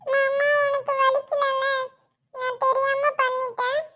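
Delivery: put-on high, slow, plaintive child voice; drawn-out childish sorry; apologetic little child. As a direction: apologise like a small, upset child. Tearful child apologises to uncle.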